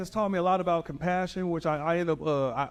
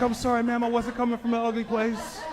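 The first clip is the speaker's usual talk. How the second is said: flustered apology; 7 semitones higher than usual. Retold or imitated. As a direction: apologise in a rush. Rep apologizes embarrassed.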